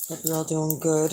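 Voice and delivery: ordinary talk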